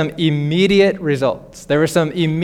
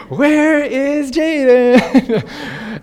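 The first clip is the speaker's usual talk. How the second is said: very slow, higher, laughing pretend search; playful seeker; 7 semitones higher, much slower than usual. Dad to giggling son hiding in bed, playful slow seeking. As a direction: pretend you can't see the lump in the bed. Parent playing hide and seek.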